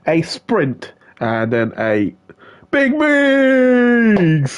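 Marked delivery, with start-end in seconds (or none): 2.7-4.6 much higher, stretched nickname call; hype shout-out. Real, not acted. Commentator hailing a driver by nickname, high hyped call. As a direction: hail him like a crowd favourite.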